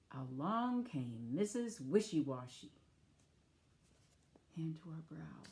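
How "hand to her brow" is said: ordinary talk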